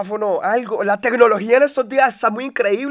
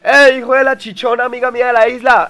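shout-out; 5 semitones higher, 6 dB louder than usual. Real, not acted. Streamer sends a loud greeting to the escort's son. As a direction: call it out like a greeting across a room.

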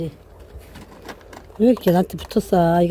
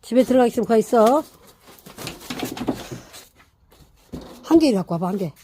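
talking to a dog; 4 semitones higher than usual. Real, not acted. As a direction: order the puppy into its house. Commanding the dog.

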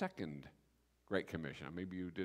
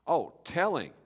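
emphatic correction; 6 semitones higher, 9 dB louder than usual. Real, not acted. Punchline correction.

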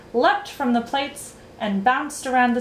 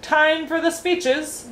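high, drawn-out royal announcement; announcing; 8 semitones higher, slower than usual. A narrator's reading. King calling banquet guests to order, announcing. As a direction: proclaim it to the whole table. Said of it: "announced the King".

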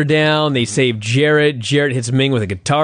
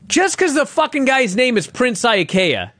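exasperated rant; 8 semitones higher than usual. Real, not acted. Ranting at bad gimmick.

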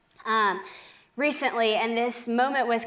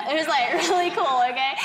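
loud, high, laughing through the words; 4 semitones higher, 4 dB louder than usual. Real, not acted. Speaker joking to host about the smart guy, laughing. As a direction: break up while saying it.

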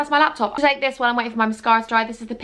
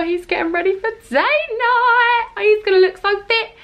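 much higher giddy squeal; giddy gushing; 9 semitones higher than usual. Real, not acted. Excited about husband's date night, high giddy gush.

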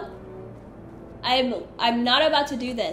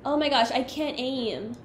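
ordinary delivery

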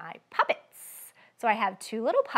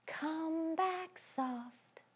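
slow whispered; hushed slow sung whisper; soft; 5 semitones higher, 13 dB quieter, much slower than usual. Shown as a demonstration. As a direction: whisper it softly.

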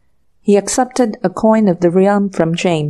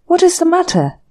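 sharp indignant challenge, pitched much higher; ferocious demand; 10 semitones higher, faster than usual. A narrator's reading. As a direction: fire it back at her, offended. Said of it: "demanded James ferociously".

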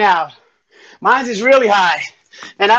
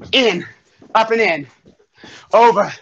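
usual speaking voice

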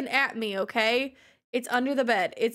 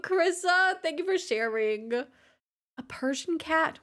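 normal talking voice

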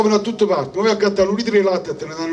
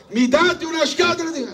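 pitched up high, a hammed-up shout; mock shouting; 8 semitones higher than usual. Customer pretending to shout at the pharmacist for a box. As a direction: raise it as if yelling across the counter. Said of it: "sto gridando".

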